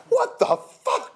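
very high, slow shrieked curse; scream. Acted. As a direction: shriek it in pain and outrage. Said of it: "he screamed".

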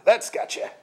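a gruff grunt; grunting brander. Acted. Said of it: "grunted the brander".

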